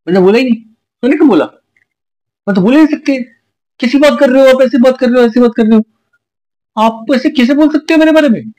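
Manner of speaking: high, indignant mock denial; shrieking fake-offended girlfriend impression; mocking Neha's denial. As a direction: pitch way up and play her outraged innocence. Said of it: "तब ये क्या बोलेगी".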